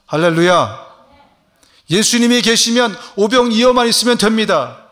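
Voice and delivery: loud, high rising proclamation; preaching shout. Real, not acted. Pastor crying out to the congregation, loud. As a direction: lift it up and proclaim it.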